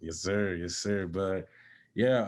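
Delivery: ordinary delivery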